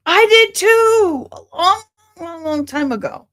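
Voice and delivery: excited. Real, not acted. Excited reply to a shared memory.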